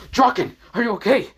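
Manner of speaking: high, loud worried shout; alarmed call. Acted. Asta checking on fallen Draken, alarmed shout. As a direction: call out urgently to an injured friend.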